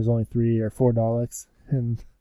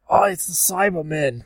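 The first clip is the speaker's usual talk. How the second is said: higher-pitched Cockney exclamation; mock Cockney; 6 semitones higher than usual. Fake Doctor spotting the Cybermen, mock Cockney. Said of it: "hardcore Cockney".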